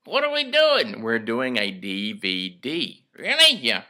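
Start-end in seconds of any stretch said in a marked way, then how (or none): 0.1-1.0 dummy voice. Acted. Ventriloquist dummy voice Eddie. 3.2-3.6 high squeaky puppet voice; dummy voice. Acted.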